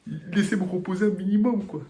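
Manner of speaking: high, whiny plea for rest; exhausted plea. Retold or imitated. Épuisé, supplie qu'on le laisse. (supplique aiguë et plaintive d'un voyageur épuisé)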